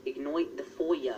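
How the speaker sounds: slow mock British accent, FOYA with oy; weird put-on accent. Shown as a demonstration. Being weird to tease British friend, slow weird accent. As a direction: draw out the silly accent on IGNOIT and FOYA. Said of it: "I always use that accent".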